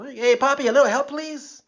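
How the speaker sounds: a mock frail senior asking a favor on the bus; elderly fare-hopper. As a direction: sweet-talk a stranger into helping, old-timer style. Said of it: "ask you to help them out".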